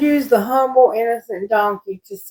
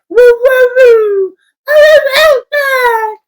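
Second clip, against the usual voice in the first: much higher, louder, crying little dog voice; 13 semitones higher, 5 dB louder, slower than usual. Retold or imitated. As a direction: whine it out like a small dog begging to go out. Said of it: "he uses his little voice to say".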